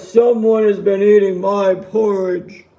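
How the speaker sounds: loud, deep bear voice; big heavy father-bear cry; Papa Bear. A narrator's reading. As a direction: boom it out low. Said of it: "He cried out in a loud voice".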